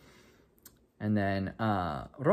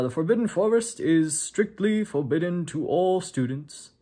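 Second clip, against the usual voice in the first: higher, slower, grave announcing voice; headmaster announcement; 7 semitones higher, 4 dB louder than usual. Retold or imitated. Headmaster announces rules to the students, slow and grave. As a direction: lay down the rule like a stern headmaster.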